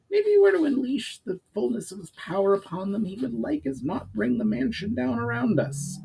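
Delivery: high, pinched goblin adviser; high-voiced goblin laying out the problem. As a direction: pitch it up like a scheming goblin. Narrator voices Urgar the goblin.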